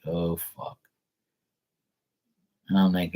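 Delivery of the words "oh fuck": low, soft under-the-breath curse; muttered dismay. Retold or imitated. Nephew realizing his aunt forgot him, muttered low curse. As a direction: say it to yourself in dismay.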